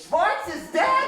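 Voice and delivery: very high, loud, slow wail; shocked wife. Acted. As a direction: shriek it in comic disbelief as the wife. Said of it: "She says".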